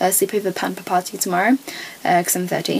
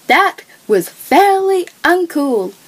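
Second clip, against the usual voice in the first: high, slow, put-on princess voice; 9 semitones higher, 5 dB louder, slower than usual. Girl quoting a storybook princess, high and slow.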